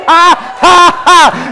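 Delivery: high, staged "ha, ha, ha"; defiant mocking laugh. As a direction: laugh at the devil in punched ha's. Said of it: "Laugh at the devil".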